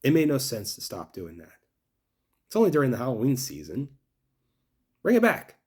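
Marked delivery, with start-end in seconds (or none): 5.0-5.6 loud demand. Real, not acted. Demanding a ride return.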